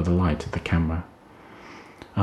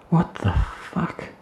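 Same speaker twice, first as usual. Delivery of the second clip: much higher, slow, unvoiced gasp; 11 semitones higher, much slower than usual. Emma dazed after the energy blast, gasping. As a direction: breathe it out in shock. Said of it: "she gasped".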